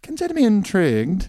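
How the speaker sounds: high, hammed-up curiosity; playfully overdone fascination; mock intrigue. Echoes the mock interest.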